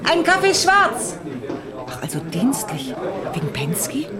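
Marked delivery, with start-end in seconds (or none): 1.9-4.1 low, whispered confidence; hushed aside. Acted. Barman whispers to the detective about Penske. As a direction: lean in and whisper the secret.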